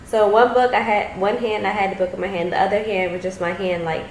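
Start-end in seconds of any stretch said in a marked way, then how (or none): none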